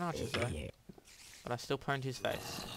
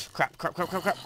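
panicked cursing; 5 semitones higher, 5 dB louder than usual. Real, not acted. Caught by mobs, repeated curse.

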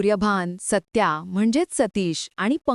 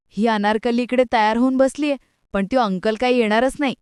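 plain reading voice

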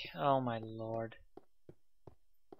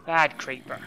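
scolding; 8 dB louder than usual. Real, not acted. Scolding a mob like a dog.

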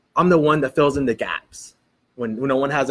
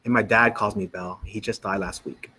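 low, subdued retelling of grief; retold tearful reply; 5 semitones lower than usual. Crying woman tells the preacher of her father's death, subdued and tearful. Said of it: "she starts to cry".

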